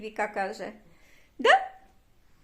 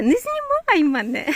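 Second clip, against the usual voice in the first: retold quote; 7 semitones higher, 3 dB louder than usual. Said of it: "скаже".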